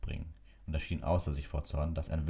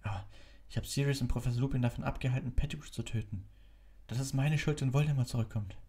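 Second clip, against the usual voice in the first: put-on higher, boyish and urgent; lightly voiced worried boy; young Harry voice; 9 semitones higher than usual. A narrator's reading.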